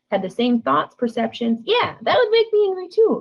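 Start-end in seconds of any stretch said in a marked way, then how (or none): 1.6-3.2 high-pitched, emphatic acted agreement; joining in empathetically. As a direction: side with them, voice leaping up. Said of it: "when you join with people like that".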